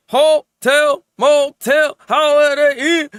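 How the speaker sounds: loud, high singsong "Hotel, motel, Holiday Inn"; singsong joke. Pretend. Anime reviewer jokingly misnames the show, loud singsong. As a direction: belt out the old rhyme as a joke.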